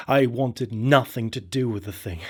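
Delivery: ordinary delivery, nothing marked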